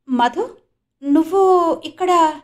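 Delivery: startled to find him here; surprised. A narrator's reading. Jyoti surprised to find Madhav at hospital, surprised. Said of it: "ఆశ్చర్యాన్నంతా కళ్ళలోనూ".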